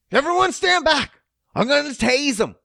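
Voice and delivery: high, loud officer warning; retold shout. Officer warning fellow cops before tasing, loud retold shout. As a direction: call out a warning to the other cops. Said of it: "And she shouts".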